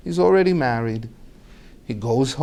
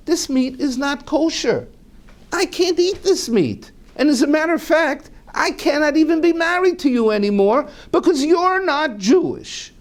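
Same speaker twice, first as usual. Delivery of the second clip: agitated declaration; 13 semitones higher than usual. Retold or imitated. Husband declaring rules agitated.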